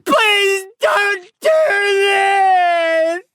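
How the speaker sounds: very slow, high-pitched wailing plea; tearful howl. A narrator's reading. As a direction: drag each word into an agonized howl. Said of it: "I howl through tears".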